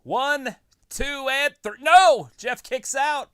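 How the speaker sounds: shouted pin count, high; wrestling commentator. Acted. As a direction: count the pin like a ref, yelling.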